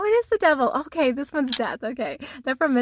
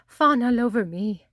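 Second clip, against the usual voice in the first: prissy; 2 semitones higher than usual. Retold or imitated. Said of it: "so prissy".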